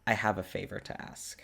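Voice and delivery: low, hesitant, earnest request; Dimitri. Acted.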